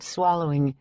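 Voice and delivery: ordinary delivery, nothing marked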